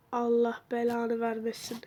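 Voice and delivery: hushed whispered curse; tense whisper in the dark. Real, not acted. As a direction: breathe the curse out under your breath. Whispered curse while hiding.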